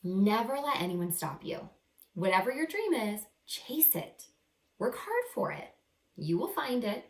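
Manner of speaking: bright, lively picture-book reading voice; animated first-person story narration; reading to children. A narrator's reading. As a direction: read it like a storybook to young kids. Reading Amelia's closing lesson aloud.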